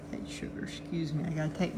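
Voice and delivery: soft whisper to the cat; whispering an apology to the cat. Real, not acted. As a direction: whisper it gently so as not to startle her. Whispers to cat on her arm.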